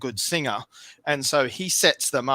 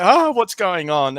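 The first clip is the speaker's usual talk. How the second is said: bewildered surprise; 6 semitones higher, 7 dB louder than usual. Retold or imitated. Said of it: "and they're like".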